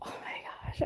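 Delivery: breathy aside to the viewers; whispered aside. Real, not acted. Vlogger whispering a joke to viewers.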